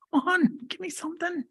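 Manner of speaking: a frustrated therapist begging for words; exasperated clinician. Retold or imitated. Clinician coaxing a nonfluent patient, high mock plea. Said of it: "that you're like".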